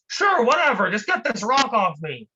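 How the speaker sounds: high and loud, fed up; trapped man, impatient. Acted. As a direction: brush off the lecture, just get me out.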